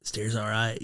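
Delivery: quiet deadpan, lightly imitated; understated dry boss voice. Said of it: "he's very dry".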